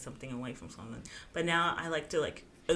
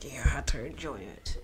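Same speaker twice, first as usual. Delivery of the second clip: low whispered order; 3 semitones lower, 3 dB louder than usual. Real, not acted. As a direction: whisper it with a grin. Joking whisper to the listeners.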